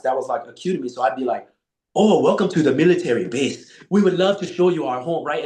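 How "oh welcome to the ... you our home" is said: put-on hospitality, playing around; lightly hammed-up welcome; joking mock welcome. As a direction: greet visitors with exaggerated politeness. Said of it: "I'm just fucking around".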